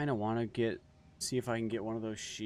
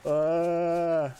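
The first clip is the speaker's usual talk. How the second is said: startled; 10 semitones higher, 11 dB louder than usual. Real, not acted. Sudden startled reaction in game.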